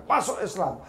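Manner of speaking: high, loud snap; barked order. Retold or imitated. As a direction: bark it like a rebuke. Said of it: "kamu membentak saya dengan mengatakan".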